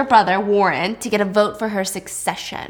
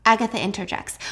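ordinary talk

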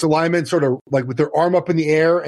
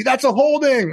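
yelling at the TV; 10 semitones higher, slower than usual. Retold or imitated. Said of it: "There are times I yell at the T V".

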